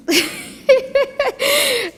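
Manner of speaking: a short nervous laugh, high. Real, not acted. Speaker steps up to the mic, nervous laugh. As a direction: laugh off the nerves before speaking.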